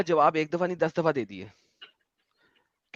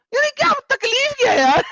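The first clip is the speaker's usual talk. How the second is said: much higher, slow incredulous whine; exasperated; 4 dB louder than usual. Real, not acted. Asking co-host what the caller's problem is, incredulous. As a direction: pitch it up in disbelief.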